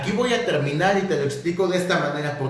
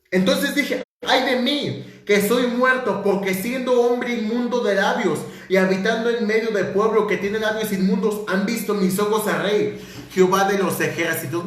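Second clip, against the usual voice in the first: higher, lifted reading of scripture; 4 semitones higher than usual. Acted. Preacher reading the seraphim verse to the church, higher and lifted. Reads Isaiah's lament aloud.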